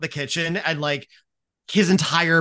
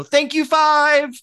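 much higher, louder, slow chorused call; theater stage-manager response; 13 semitones higher, 8 dB louder, much slower than usual. Acted. Whole fraternity answering the countdown call, loud theatrical crew response. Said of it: "in a very theater, stage manager response".

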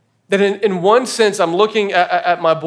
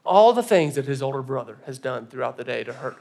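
normal talking voice

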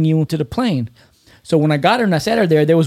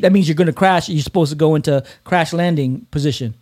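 normal talking voice

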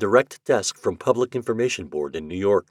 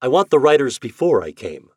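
impatient, louder, brushing it off; annoyed; 6 semitones higher, 8 dB louder than usual. A narrator's reading. Shandor brushes off the clerk, annoyed. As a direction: cut her off, a little irritated. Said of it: "He scowled in annoyance".

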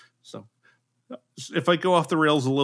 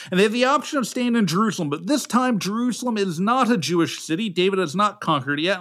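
usual speaking voice

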